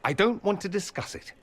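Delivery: high, agitated refusal; irritated refusal. Acted. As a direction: shut the argument down, voice shooting up. Cuts off quarrel about the debt.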